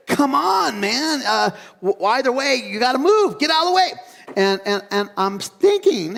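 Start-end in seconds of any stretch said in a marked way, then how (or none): 0.1-3.9 impatient mock yell. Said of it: "I'm like".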